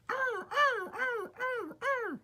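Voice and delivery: very high squealing cries of toy cars; screaming freight cars. A narrator's reading. Freight cars shrieking as Edward jerks them. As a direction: shriek like startled little wagons. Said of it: "screamed the cars".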